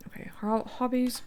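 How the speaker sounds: talking with a mouth full of food; chewing while talking, mouth full. Real, not acted. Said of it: "I'm talking with my mouth full".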